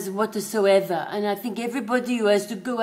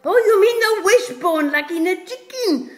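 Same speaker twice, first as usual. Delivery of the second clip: high loud dawning surprise; sudden realisation; 10 semitones higher, 7 dB louder than usual. Acted. Owner realising the dog means the chicken wishbone, high and loud. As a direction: land on it with a burst of realisation.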